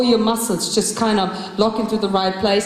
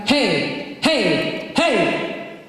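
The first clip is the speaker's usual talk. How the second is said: punchy hey calls from the gut; three quick called heys; short calls of hey; much slower than usual. Shown as a demonstration. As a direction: pump out each hey. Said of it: "Or like".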